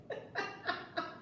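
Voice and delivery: a hushed airy laugh; soft high giggle. Real, not acted. Host laughing at Tyler's comeback, soft giggle.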